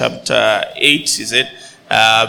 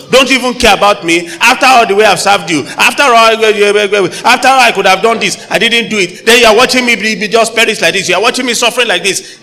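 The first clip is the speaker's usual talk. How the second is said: higher, louder, fast aggrieved wail; aggrieved complaint; 6 semitones higher, 4 dB louder than usual. Retold or imitated. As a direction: pour out the hurt of a loyal servant ignored. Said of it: "and that's the way they sounded".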